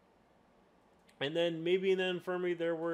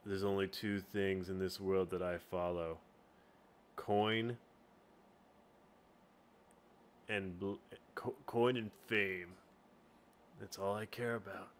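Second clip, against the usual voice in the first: low, soft mercenary voice; Zinc voice; 6 semitones lower, 5 dB quieter than usual. Shown as a demonstration. Game master voices coin-and-fame seeker, low and soft. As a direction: keep it low and quiet, with pauses. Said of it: "And then you have Zinc".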